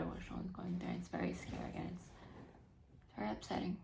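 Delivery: very soft breathy voice; hushed. Real, not acted. Reader admitting her fear, very soft. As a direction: barely say it. Own mother in hospital.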